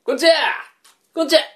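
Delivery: loud call at the door. Acted. Young man calls at door.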